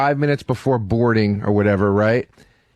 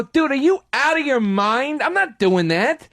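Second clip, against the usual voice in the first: retold yelling; 12 semitones higher than usual. Traveler yelling at the airline desk agent, high and indignant. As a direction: rant at the gate agent like you've lost it. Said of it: "you're a dick just yelling at him".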